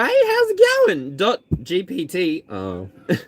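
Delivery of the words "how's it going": very high, slow, loud hello; excited greeting. Real, not acted. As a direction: stretch it out high and bright. Greeting a viewer.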